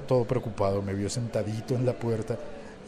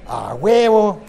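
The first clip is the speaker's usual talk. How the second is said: high, loud burst; imitated Mexican exclamation; 7 semitones higher, 10 dB louder, much slower than usual. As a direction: belt it out like a popular cheer. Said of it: "¿Sabe qué dicen también con mucha frecuencia?".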